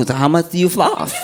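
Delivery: "floss" lisped, like "flaff"; lisping dentist. Retold or imitated. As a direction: lisp the question. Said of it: "it's making him lisp".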